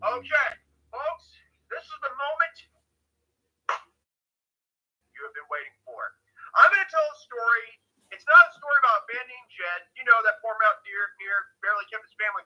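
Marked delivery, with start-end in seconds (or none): 0.0-6.2 frantic mock sports commentary. Hyping up his story like an announcer.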